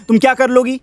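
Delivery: ordinary delivery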